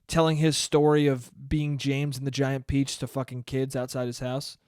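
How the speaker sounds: ordinary talk, nothing marked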